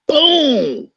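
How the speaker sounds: high loud boom; slam sound effect. Retold or imitated. Teller acting out the coach body slamming the rival, loud boom. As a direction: mimic the crash.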